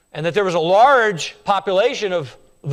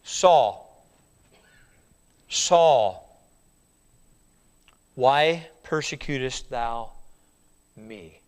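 slow, lower solemn calling voice; voice from heaven; 5 semitones lower, much slower than usual. Acted. Jesus calling Saul from heaven, slow and lower. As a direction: call the name slow and grave. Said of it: "heard a voice saying unto him".

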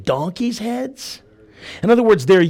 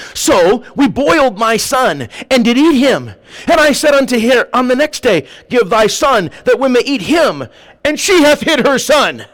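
higher, forceful Bible reading; dramatic scripture reading; 6 semitones higher, 4 dB louder than usual. A narrator's reading. Woman accuses her neighbour before the king, dramatic reading. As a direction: read out the woman's grievance with rising force.

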